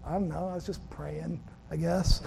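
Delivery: soft casual shrug of a reply; offhand friend reply. Retold or imitated. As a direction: toss it off quietly, like it's nothing.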